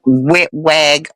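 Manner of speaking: wet rag with r said as w; mimicking the man saying wet wag; imitating his accent. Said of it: "is what he would".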